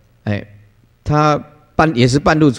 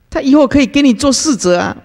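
much higher, earnest parent's pitch; eager parent; 11 semitones higher than usual. Retold or imitated. Father urging the master to take his toddler son, much higher and eager. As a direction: pitch it up like a keen father making his offer.

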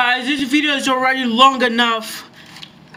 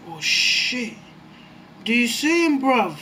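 usual speaking voice

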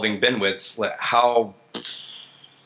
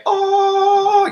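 a squeaky, much higher, louder "Oh"; delighted oh; 6 dB louder than usual. Retold or imitated. Engineer reading a promising abstract, delighted squeal. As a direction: gasp with sudden glee.

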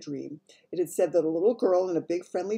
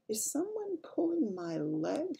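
high, slow puzzled thought; wondering; 10 semitones higher, 3 dB quieter, slower than usual. A narrator's reading. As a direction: wonder it slowly to yourself. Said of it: "she wondered".

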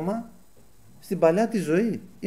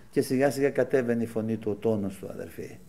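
low voice, fading softer to the end; voice coming down; 6 semitones lower, 5 dB quieter than usual. Shown as a demonstration. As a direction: let pitch and volume sink gradually. Mirrors the voice dropping down.

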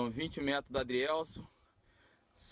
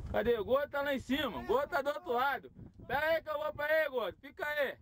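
high, raised voice calling across to a friend; 9 semitones higher than usual. Real, not acted. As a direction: shout over to your buddy on the other side.

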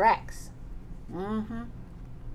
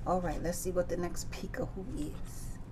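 whisper; 5 semitones lower, 11 dB quieter than usual. Real, not acted. Soft aside turning the page.

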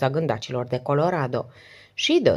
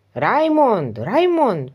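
loud calls, pitched high; calling out; 6 semitones higher, 3 dB louder, much slower than usual. A narrator's reading. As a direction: call for the missing servant across the house. Calling out for the servant.

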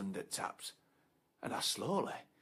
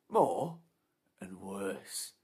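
normal talking voice

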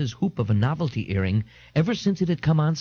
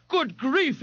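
higher, startled gasp of words; a touch of panic, higher; 12 semitones higher than usual. A narrator's reading. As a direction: gasp it out in alarm. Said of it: "Ignatius gasped".

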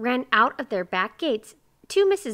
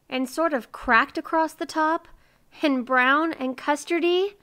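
higher, louder, longing question; trembling; 8 semitones higher, 5 dB louder than usual. A narrator's reading. Miser longs to hear about the pies, trembling. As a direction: ask with a touch of tremble. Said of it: "his voice trembling".

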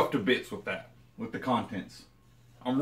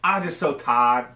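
imitating Angela; 6 semitones higher, 6 dB louder than usual. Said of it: "Angela says this all the time".

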